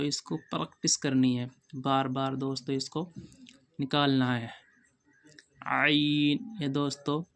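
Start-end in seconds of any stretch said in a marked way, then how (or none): none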